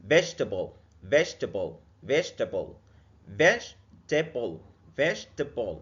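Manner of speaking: vegetable as vej-ta-bl in three beats; vegetable in three syllables; three-syllable demo. Shown as a demonstration. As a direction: drop the middle e in vegetable. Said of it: "متقسمة على تلات مقاطع".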